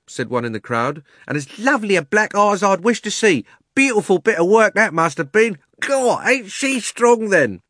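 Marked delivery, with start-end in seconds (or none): 1.3-7.6 cheeky Cockney bystander. Acted. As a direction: savour the gossip for the crowd. Crowd man voiced.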